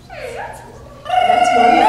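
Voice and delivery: piercing high ululation; high zaghareet trill. Shown as a demonstration. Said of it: "ways that are really great to show appreciation".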